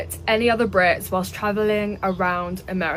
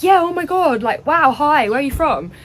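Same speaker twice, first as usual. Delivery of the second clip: higher-pitched, mock-delighted greeting; gushing enthusiasm; 5 semitones higher than usual. What the British lad didn't say to tourists, gushing. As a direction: greet a fellow countryman as if thrilled. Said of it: "He wasn't like".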